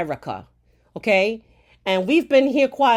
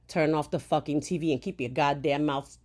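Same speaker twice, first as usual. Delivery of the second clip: ordinary talk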